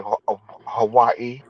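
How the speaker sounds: "Hawaii" tried haltingly, syllable by syllable; low, tentative "Hawaii", stumbling on the first syllable; attempted local pronunciation. Shown as a demonstration. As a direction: feel out "Hawaii" as locals might say it. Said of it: "that's how they pronounced it".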